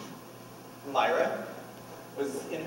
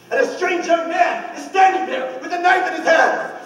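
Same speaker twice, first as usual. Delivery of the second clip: loud, high, frantic storytelling; alarmed retelling; 13 semitones higher, 8 dB louder than usual. Retold or imitated. Husband telling police of the man with a knife, alarmed. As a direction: burst into panic as you relive it. Acting out a knife intruder's arrival.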